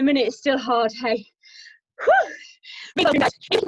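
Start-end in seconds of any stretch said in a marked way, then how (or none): 2.0-2.3 high, loud "whew"; winded whoop. Real, not acted. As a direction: blow off the effort with a whoop. Winded after a hard minute.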